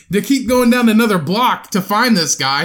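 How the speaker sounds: ordinary talk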